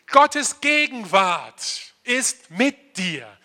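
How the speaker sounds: each word laid down slowly, GOTTES stressed; slow emphatic declaration. Shown as a demonstration. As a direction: preach it slowly and firmly so they repeat it. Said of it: "Sag dir das mal selber". (langsam und eindringlich, Betonung auf Gottes)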